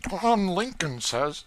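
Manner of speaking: put-on high, eager boy's voice; young George voice. A narrator's reading. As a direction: beg hungrily for the cake.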